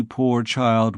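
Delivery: plain reading voice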